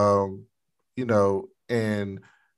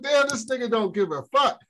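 much higher, amazed disbelief; stunned reaction; 8 semitones higher than usual. Retold or imitated. Viewer shocked at the father's rudeness. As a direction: react like you can't believe it.

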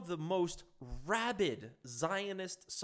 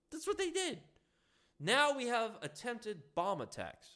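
ordinary talk

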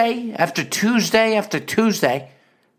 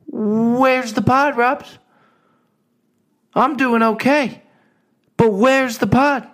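higher, slow nagging mother; mock mom voice, pitched up and insistent; mom's demanding voice; 6 semitones higher, slower than usual. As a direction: play the pushy mom demanding her show. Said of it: "she cranks it up a couple notches".

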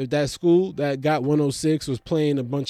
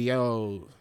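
scolding ball player; 4 semitones lower than usual. Retold or imitated. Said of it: "And he's like".

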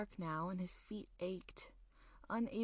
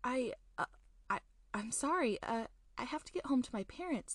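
put-on higher, louder, rushed frightened boy; scared stammer; 5 semitones higher, 5 dB louder, faster than usual. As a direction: stammer it out in a panic.

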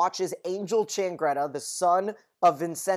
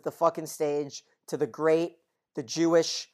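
ordinary delivery

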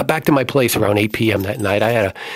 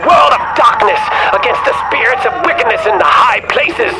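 preaching exorcist; 13 semitones higher, 7 dB louder than usual. Acted. Priest on the tape preaching the exorcism prayer at full force. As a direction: thunder the prayer against the demon. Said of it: "A man preached".

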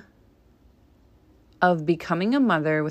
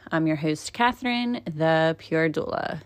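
usual speaking voice

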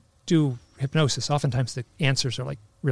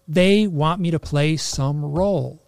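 emotional; 3 semitones higher, 7 dB louder, slower than usual. Retold or imitated. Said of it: "super emotional".

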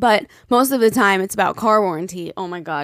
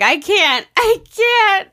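tearful retelling; 12 semitones higher, 7 dB louder, much slower than usual. Retold or imitated. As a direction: voice cracking high into a cry, imitating herself. Retelling crying with friend.

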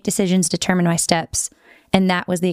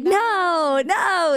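high, drawn-out acted objection; mock protest; 11 semitones higher than usual. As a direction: wail a playful refusal way up high. Acting out protest at weirdness.

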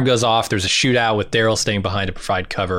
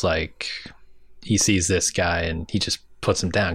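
whispering; 4 semitones lower, 5 dB quieter than usual. Real, not acted.

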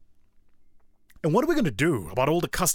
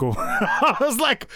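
laughing; 11 semitones higher, 4 dB louder, much slower than usual. Real, not acted. Laughing at the scam reveal.

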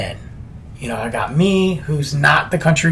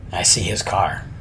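hushed, unvoiced whisper; 10 semitones lower, 8 dB quieter than usual. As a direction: whisper so nobody hears you leave. Sneaking out past his car.

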